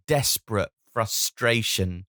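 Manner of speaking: ordinary talk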